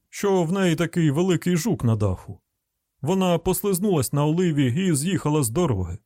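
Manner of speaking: grumbling hillbilly car owner; rustic householder. Acted.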